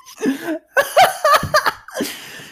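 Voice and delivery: long, high, wheezing laughing fit; hysterical laugh. Real, not acted. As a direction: laugh helplessly at your own silliness. Cracks up at own bit.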